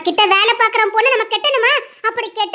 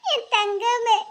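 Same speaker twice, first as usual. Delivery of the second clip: tearful lament; 2 semitones higher than usual. Acted. Mother laments missing daughter.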